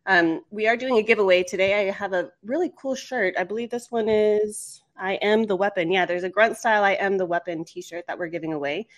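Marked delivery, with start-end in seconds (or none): none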